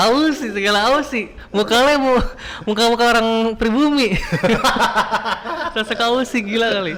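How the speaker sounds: high-pitched, cracking up while teasing; incredulous ribbing, pitched high with laughter; laughing mockery. Real, not acted. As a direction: tease a friend through helpless laughter. Teasing friend's claimed origin, laughing.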